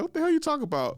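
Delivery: shocked high voice. Retold or imitated. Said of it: "I was like".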